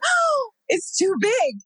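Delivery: high, slow, alarmed gasp of a line; panicked at the baby's head. Retold or imitated. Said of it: "And I was like".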